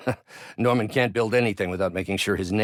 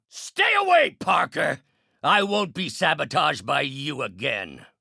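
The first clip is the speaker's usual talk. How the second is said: shouted warning sinking to a low growl; angry villain threat; threatening warning; 11 semitones lower than usual. Acted. Villain warns off the hero.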